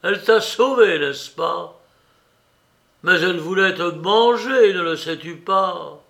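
sarcastic exclamation; ironic. A narrator's reading. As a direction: needle them with mock wonder. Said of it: "s'écria Rakitin avec ironie".

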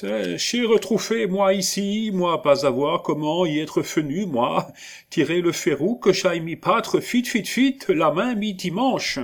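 put-on high, broken French with a German accent; the big German stammers out his story. A narrator's reading. Hermann explains to Jacques Aubry, in a thick German accent. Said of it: "accent tudesque".

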